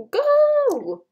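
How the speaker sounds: high, drawn-out urging shout; excited cry. Real, not acted. Player urging the character on, excited cry.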